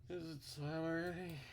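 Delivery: high, groggy question; sleepy character voice. Acted. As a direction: ask it like just waking up. Voices the protagonist.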